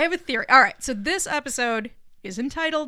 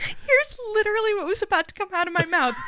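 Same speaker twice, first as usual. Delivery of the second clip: normal talking voice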